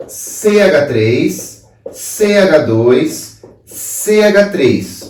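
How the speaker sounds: very slow, spaced-out letters and numbers; slow dictation. Real, not acted. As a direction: dictate it piece by piece as you write. Dictating a formula while writing it.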